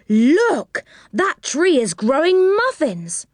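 put-on high, amazed child's voice; surprised calling out. A narrator's reading. Children discovering cake trees, calling out in high-pitched surprise. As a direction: exclaim at each new wonder, pitched well up. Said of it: "called out in surprise".

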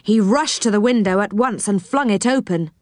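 plain reading voice

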